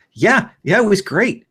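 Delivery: put-on chirpy kid voice. Player voices eager kid.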